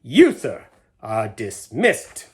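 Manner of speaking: loud, then slow final verdict; stern dismissal. A narrator's reading. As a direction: fire him coldly and slowly. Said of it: "pointing a dagger-like finger".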